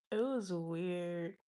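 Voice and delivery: ordinary talk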